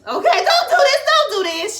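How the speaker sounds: high, loud begging at the screen; frantic mock plea. Viewer begs him to stop, frantic, high and loud. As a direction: yell it in comic panic.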